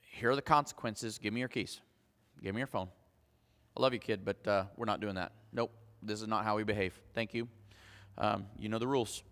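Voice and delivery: a calm parent handing out consequences; calm quiet discipline. Shown as a demonstration. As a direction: give the consequence gently, no anger. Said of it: "coming to this place of saying".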